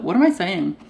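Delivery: ordinary talk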